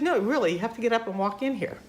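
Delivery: lightly imitated insistence; mock insisting. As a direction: urge them, pitched well up.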